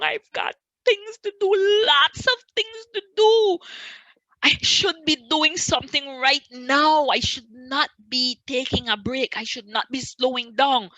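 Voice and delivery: acted-out panic, pitched high and loud; anxious protest. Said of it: "I was anxious".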